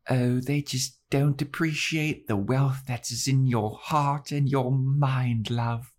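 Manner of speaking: high, slow, dreamy Drusilla voice; Drusilla impression. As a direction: coo at him like a mad vampire lady. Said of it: "Drusilla's like".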